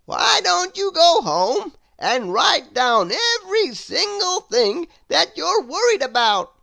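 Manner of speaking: loud, high kindly advice; booming friendly wizard; helpful wizard. Acted. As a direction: offer the plan grandly. Wizard voiced in story.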